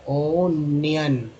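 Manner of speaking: ओनियन drawn out, ओ first; ओनियन very slowly; slow clear word. Shown as a demonstration. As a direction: stretch ओनियन, open ओ. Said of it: "यहाँ पर अन".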